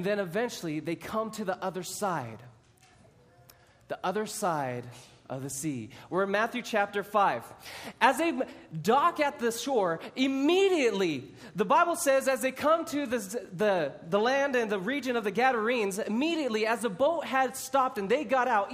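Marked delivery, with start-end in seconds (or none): none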